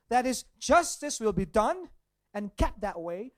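loud, high, driving preacher's cadence; rising sermon intensity; preaching at full force. Acted. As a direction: hammer each point home to the church.

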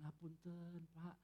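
very soft, meek Javanese apology; gentle Solo politeness. Acted. Preacher mimics a Solo person whose foot is stepped on, gentle. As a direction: imitate an over-polite Solo speaker, softly. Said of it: "berkata-kata dengan lemah lembut".

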